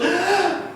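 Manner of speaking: high sharp gasp then a yelp; mock startled gasp. Imitating the kid hitting his nose on the candle wick, high startled yelp. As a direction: jerk back with a yelp. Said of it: "and he just goes".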